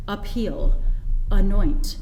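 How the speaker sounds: "Appeal" and "anoint" with a light schwa start; weak first syllable. Shown as a demonstration. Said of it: "It's like the beginning of a word".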